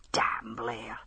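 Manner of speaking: hushed curse under the breath; a private whispered curse at Blair. A narrator's reading. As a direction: whisper it to yourself, bitter. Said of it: "thought Hamish".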